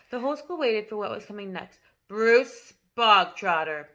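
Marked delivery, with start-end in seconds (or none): 2.1-3.9 slow, loud headmistress bark of a name; sudden bark. A narrator's reading. Headmistress barking a pupil's name before the school. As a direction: call him out like a drill sergeant. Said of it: "barked suddenly".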